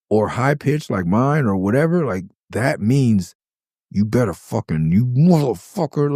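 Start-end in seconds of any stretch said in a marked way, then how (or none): 4.9-6.1 higher, slow, pressed through teeth; gritted-teeth threat. Retold or imitated. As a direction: spit it through clenched teeth. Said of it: "gritting your teeth".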